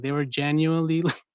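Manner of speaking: ordinary delivery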